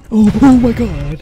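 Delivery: high, loud jolt of fright; startled cry. Real, not acted. Player jolted by the horror game, startled cry. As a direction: yelp it out, startled.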